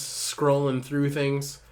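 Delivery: ordinary talk, nothing marked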